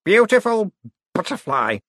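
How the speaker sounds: high, loud then fading; Cosmo rambling. Acted. Cosmo babbling as he falls apart, high then fading. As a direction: drift off into nonsense.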